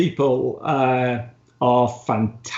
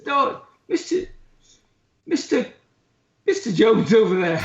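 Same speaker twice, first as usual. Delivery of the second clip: much higher, mock Indian accent; Indian accent imitation; 8 semitones higher than usual. The barmaid sending the traveller to Mister Jones, in an imitated Indian accent. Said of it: "I thought she was from India".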